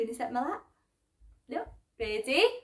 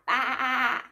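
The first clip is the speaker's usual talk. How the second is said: sheep bleat. Pretend. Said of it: "What does a sheep say?".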